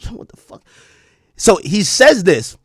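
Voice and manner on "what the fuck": whispered, baffled aside; whispered disbelief. Retold or imitated. Host reacts to random phrases on the call, whispering in disbelief. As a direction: whisper it to yourself, stunned. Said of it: "I'm like".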